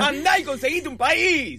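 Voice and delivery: high-pitched yelled tirade; furious rant. Acted. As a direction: rip into them at the top of your register. Sigue la arenga furiosa.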